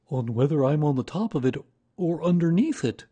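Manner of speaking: high, loud bear voice; Pooh, earnest explainer. A narrator's reading. Pooh explains his boat to Christopher Robin, earnest high bear voice.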